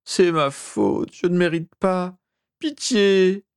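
high wail rising to a plea; sobbing. A narrator's reading. Master begging for pity, high desperate sobbing. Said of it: "sanglota Régulus".

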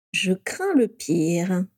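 very slow resigned sigh; slow wry dread, half amused. Acted. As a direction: drag it out, holding back a smile. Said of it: "soupira Rémus en réprimant un sourire".

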